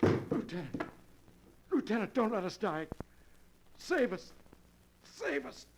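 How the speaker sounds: high, rising panic in each cry; desperate plea. Acted. Dying husband pleads with the lieutenant, voice climbing in panic.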